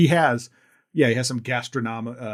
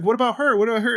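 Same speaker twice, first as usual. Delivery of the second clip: mock assistant; 11 semitones higher, 4 dB louder, faster than usual. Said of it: "and he's like".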